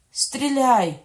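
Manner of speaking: high, very loud order; shouted command. A narrator's reading. Queen orders guards to fire, shouted command. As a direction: yell it as an urgent command.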